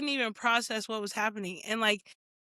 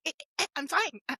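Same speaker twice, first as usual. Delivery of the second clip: stuttering like a glitching machine; mock malfunctioning voice; glitching robot; 3 semitones higher, faster than usual. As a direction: break up the words like a robot that's jammed. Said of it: "literally glitching".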